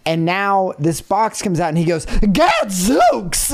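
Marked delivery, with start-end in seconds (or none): 2.1-3.5 high, thrilled gasp of a word; excited exclamation. Retold or imitated. Mocking the professor to friends, excited high exclamation. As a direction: blurt it out like a delighted professor.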